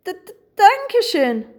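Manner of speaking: high-pitched stuttering thank-you; stammering. A narrator's reading. Overwhelmed hare thanks the rainbow, high stammer. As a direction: trip over the first syllable, then release it. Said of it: "stammelte Pieps".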